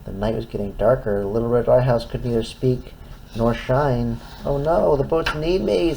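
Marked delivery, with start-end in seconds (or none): 4.4-5.9 worried. Acted. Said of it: "said the lighthouse".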